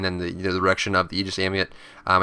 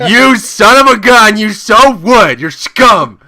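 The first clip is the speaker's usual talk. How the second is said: much higher, louder, slow seething insult; mock fury; 11 semitones higher, 12 dB louder, much slower than usual. Player fuming at enemy first-picking Agni, hammed-up fury. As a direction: ham up betrayed rage at the enemy.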